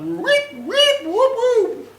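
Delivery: loud, very high droid whoops; imitated robot beeping, shrieked high; R2-D2 whistles. R2-D2 as everyone else hears him, squealed whistles. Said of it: "What does it sound like to everyone else".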